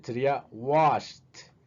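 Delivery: slow "washed" with a clear T; slow T ending. Shown as a demonstration. Teacher modelling "washed" for students. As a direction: stretch "washed" and end on T. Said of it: "se va a pronunciar como T".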